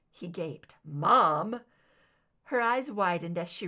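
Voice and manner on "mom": loud scandalized Mom!; a louder shocked cry of disbelief; shocked outburst. Acted. Son shocked by his mother's risqué joke, loud outburst.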